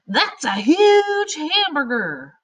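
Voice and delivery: high, slow, loud amazed yell; yelling exclamation. Shown as a demonstration. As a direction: shout it like you can't believe the size. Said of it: "That means you're yelling".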